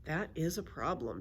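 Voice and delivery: ordinary delivery, nothing marked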